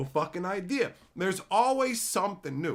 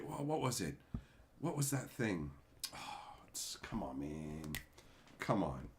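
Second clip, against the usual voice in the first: real whisper, straining to remember; whispered frustration; 11 semitones lower, 13 dB quieter than usual. Real, not acted. Streamer whispering to himself while searching memory. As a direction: breathe it out while hunting for a word.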